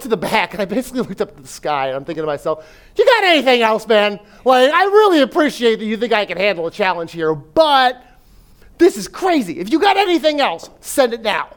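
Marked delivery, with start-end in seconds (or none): 2.9-11.5 higher and louder, pleading in mock exasperation; exasperated plea to heaven. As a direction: throw it up at the sky like a frustrated half-joke. Exasperated plea to God, retold.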